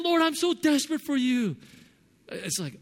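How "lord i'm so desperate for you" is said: high, louder mock-desperate plea; mocking Loke's prayer. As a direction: parody the daily desperate prayer. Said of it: "when he prays, he's really loud".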